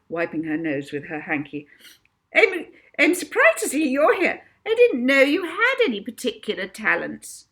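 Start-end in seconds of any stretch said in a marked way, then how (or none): 2.3-7.5 much higher, louder, sniffy schoolmistress voice; snooty teacher. Acted. Missus Toadley sneering at Molly in the waiting room, high and haughty. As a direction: look down your nose at the girl.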